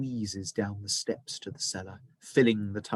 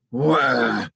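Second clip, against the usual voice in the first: grind it out like granite slabs; slow stony menace; grinding stone voice; 2 semitones higher, 8 dB louder than usual. A narrator's reading. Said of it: "in a voice that sounds like two wet slabs of granite being scraped".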